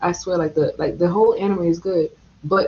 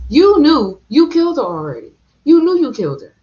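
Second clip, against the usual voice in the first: angry; 7 semitones higher, 8 dB louder than usual. Real, not acted. Angry rant at the villain.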